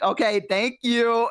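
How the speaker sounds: high, slow, drawn-out thanks; acted sheepish thanks. Caller thanking annoyed clerk, high slow sheepish thanks.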